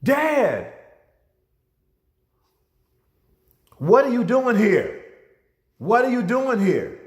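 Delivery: high, loud astonished cry; amazed son. Retold or imitated. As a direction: call out up high, shocked to see him. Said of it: "I said".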